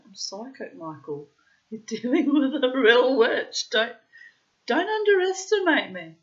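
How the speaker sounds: 3 ham it up as a laughing witch; 1 playful witch boast. Retold or imitated. 4 wife warning husband she is a real witch, very high hammed-up voice.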